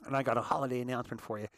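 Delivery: normal talking voice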